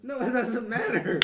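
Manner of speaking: loud, high, drawn-out objection; real amused outburst; laughing protest. Real, not acted. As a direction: shout it down through laughter. Teasing protest over his excuse.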